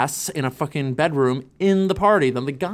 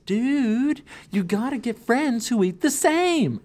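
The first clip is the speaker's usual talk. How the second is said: high, slow, laid-back stoner dude; high, slow hippie-guy voice; 9 semitones higher, slower than usual. Retold or imitated. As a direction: drawl it like a mellow hippie. Voicing the hippie diet guy.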